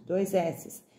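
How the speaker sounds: ordinary talk, nothing marked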